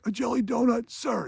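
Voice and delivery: high put-on recruit reply; imitating recruit. Recruit answers sergeant about the donut, high mock reply. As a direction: answer as a mock cadet under questioning.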